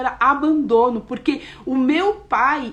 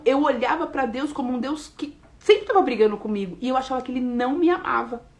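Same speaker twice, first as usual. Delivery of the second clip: ordinary talk, nothing marked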